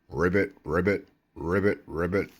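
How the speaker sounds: playful ribbits like a cartoon frog; singsong frog noises; frog croak. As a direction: croak it out for a child. Frog picture, imitating frog croak.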